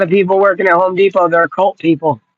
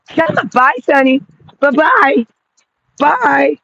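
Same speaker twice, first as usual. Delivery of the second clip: high lilting bye-bye; high cooing farewell to the pet; sing-song goodbye to dog; 6 semitones higher, slower than usual. Real, not acted. Calling the dog.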